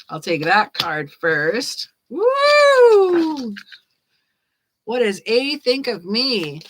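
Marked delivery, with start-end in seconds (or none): 2.1-3.5 high, loud woo; excited whoop. Real, not acted. Reader cheering the card pulled first, excited whoop.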